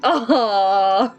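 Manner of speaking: ordinary delivery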